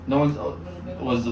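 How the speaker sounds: normal talking voice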